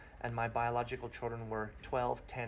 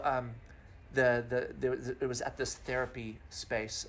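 usual speaking voice

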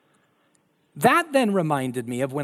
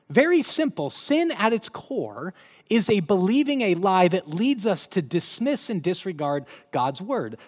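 ordinary talk

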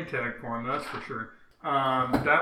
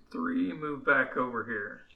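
ordinary talk, nothing marked

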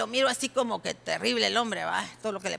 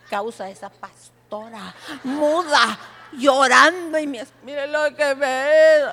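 mock complaint; 2 semitones higher, 11 dB louder, slower than usual. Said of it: "Que va a decirle".

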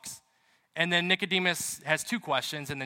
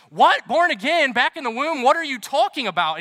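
loud, high, disbelieving cry; incredulous outburst; 8 semitones higher, 8 dB louder than usual. Retold or imitated. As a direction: blurt it out in shock at the idea. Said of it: "And Nicodemus goes".

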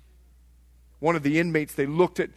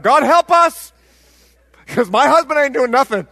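loud, high yell up to God; crying out; 7 semitones higher, 14 dB louder than usual. Retold or imitated. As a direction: imitate a fed-up wife hollering her prayer. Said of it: "I'm crying out".

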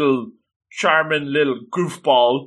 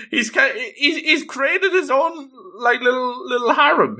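laughing; 10 semitones higher than usual. Real, not acted. Laughing while recapping harem joke.

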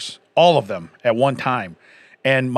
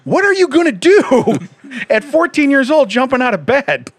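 high, loud, laughing disbelief; laughing incredulous; 11 semitones higher, 6 dB louder than usual. Retold or imitated. Father to teenage son, laughing in disbelief. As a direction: laugh through the question.

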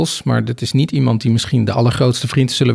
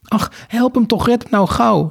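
high-pitched begging; pleading; 10 semitones higher than usual. A narrator's reading. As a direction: beg for someone's rescue in a high voice. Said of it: "smeekte Sophie". (hoog smekend)

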